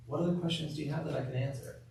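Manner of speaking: soft, quick, open question to the patient; gentle question. Shown as a demonstration. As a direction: ask it softly, inviting an answer. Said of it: "Gives me another chance to talk".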